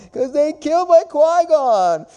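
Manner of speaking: high, whiny mock sobbing voice, slowing down; hammed-up heartbroken fan wailing to her therapist; mock crying girlfriend.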